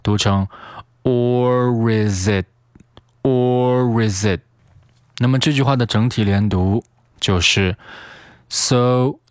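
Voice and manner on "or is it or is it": "or is it" slowly joined as o-ri-zit; slow, unbroken "or is it", like one word; linked "or is it". Shown as a demonstration. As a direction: link r and z through "or is it". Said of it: "is和it再进行连读".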